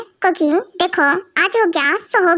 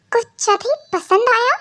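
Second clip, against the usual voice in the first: shocked echo; 5 semitones higher than usual. Acted. Wife shocked at husband's hint.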